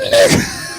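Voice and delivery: very high yelled word; high shout. Pretend. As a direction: yell it from the car, high and loud. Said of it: "That motherfucker said".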